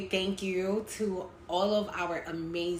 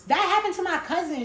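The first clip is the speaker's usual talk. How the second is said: excited; 11 semitones higher, 6 dB louder than usual. Real, not acted. Excited interjection.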